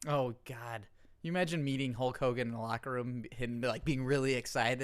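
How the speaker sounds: normal talking voice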